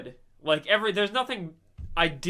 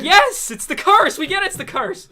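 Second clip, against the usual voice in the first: hammed-up fed-up viewer, cracking up; exasperated mock reply; 13 semitones higher, 13 dB louder than usual. Exasperated viewer answering the show.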